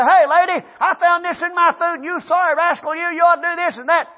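high, loud mock berating; angry scolding. Diner to waitress over the food, angry scolding. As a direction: chew her out at full tilt.

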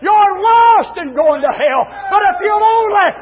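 high, drawn-out preaching shouts; full-force preaching. Real, not acted. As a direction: cry it out to the sinners.

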